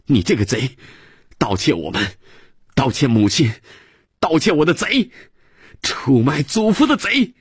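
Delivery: put-on a furious boy piling on the accusations; child's indignant yelling rising to a shriek; angry child's shout, building. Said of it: "仰着脸气哼哼的又喊".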